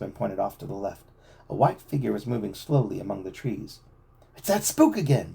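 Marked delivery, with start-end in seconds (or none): 4.4-5.3 loud excited cry, higher; exclaiming. A narrator's reading. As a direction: shout it out in surprise. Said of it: "Joe exclaimed".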